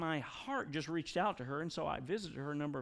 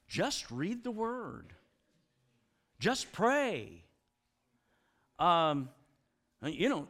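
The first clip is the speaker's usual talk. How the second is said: mocking pious advice; 4 semitones higher, 4 dB louder than usual. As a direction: parrot the glib advice back. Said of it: "weary with the just statements".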